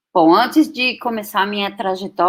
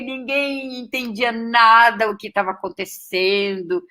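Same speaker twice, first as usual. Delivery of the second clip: ordinary delivery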